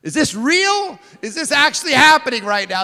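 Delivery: loud, high-pitched incredulous questions; aghast storyteller reliving his disbelief; shocked disbelief. Retold or imitated. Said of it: "I'm in shock".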